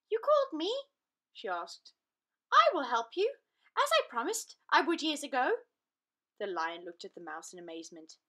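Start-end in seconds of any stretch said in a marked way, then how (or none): 0.1-0.9 high, tiny mouse voice; a bright little mouse peeping up; squeaky mouse. Acted. Mouse answering the trapped lion, high and squeaky. 2.5-5.7 high and loud mouse voice; a small creature standing tall; determined little mouse. Acted. Mouse promising the trapped lion help, high and firm.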